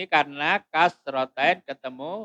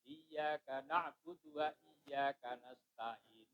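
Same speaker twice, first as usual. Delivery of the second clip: linked recitation; 13 dB quieter, slower than usual. Shown as a demonstration. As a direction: recite quietly, then punch the linking syllable "Nuh".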